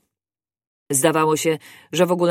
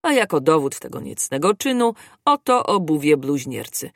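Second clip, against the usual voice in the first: plain reading voice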